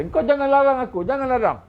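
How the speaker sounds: high, loud begging not to be stopped; pleading protest. Acted. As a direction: plead with them not to hold you back. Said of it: "Maka dia kata".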